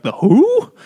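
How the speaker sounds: high, baffled mock question; mock bewilderment. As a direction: squeak up in exaggerated confusion. Mocking her clueless interjection.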